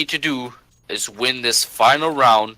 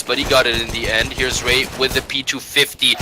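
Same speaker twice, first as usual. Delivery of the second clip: usual speaking voice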